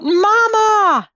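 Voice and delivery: high, drawn-out mock yell; storyteller's squealed cry for mother. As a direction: call out high like a newborn in a tale. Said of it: "yells out".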